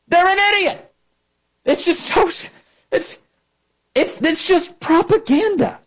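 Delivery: high, heated outrage; rising exasperated tirade; indignant rant. Real, not acted. Ranting at Obama defenders.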